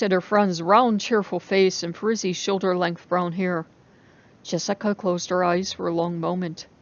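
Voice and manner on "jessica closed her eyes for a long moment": ordinary delivery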